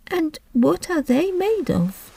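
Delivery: put-on high, slow girlish curiosity; curious. A narrator's reading. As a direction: ask eagerly, wide-eyed. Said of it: "a tone of great curiosity".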